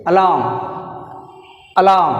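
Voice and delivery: "alarm" as a-LAAM, stressed second syllable; silent r. Shown as a demonstration. Teacher showing silent letter, alarm said a-LAAM. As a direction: drop the r and punch LAAM in "alarm". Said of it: "ఆర్ silent".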